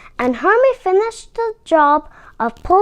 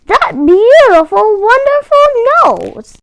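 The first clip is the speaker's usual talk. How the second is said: admiring Santa; 7 semitones higher, 5 dB louder than usual. A narrator's reading. Santa admires Rudolph's nose.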